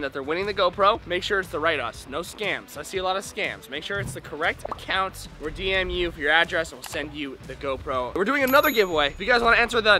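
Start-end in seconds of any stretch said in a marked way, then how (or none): none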